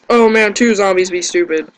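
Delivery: ordinary talk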